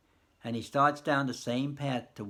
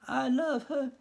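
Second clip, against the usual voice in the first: put-on high, loud, tearful old man; grieving old husband; 12 semitones higher, 4 dB louder, slower than usual. Harley telling Nicely Nicely he loves her, high and grieving. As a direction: cry out his love for her. Harley declares his love.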